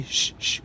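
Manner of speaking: soft, drawn "shh" repeated; hushing shh. Shown as a demonstration. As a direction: hush the listeners with a breath. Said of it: "you could say".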